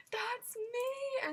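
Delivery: high excited squeal; retold freaking out. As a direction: answer in a thrilled, high cry. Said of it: "we all start freaking around out".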